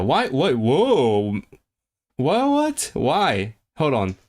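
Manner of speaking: startled, pitch leaping up on each question; taken aback. Real, not acted.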